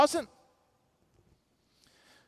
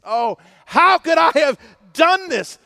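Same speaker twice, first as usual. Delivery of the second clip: anguished cry; 7 semitones higher, 7 dB louder than usual. Retold or imitated. Voicing repentant brokenness.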